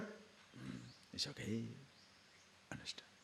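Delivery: hushed whispered question; a soft whispered check-in. Real, not acted. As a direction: lean in and whisper it. Whispered aside to audience.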